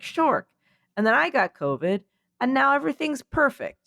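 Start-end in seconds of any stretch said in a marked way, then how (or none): 0.0-0.5 mock alarm. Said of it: "someone was like".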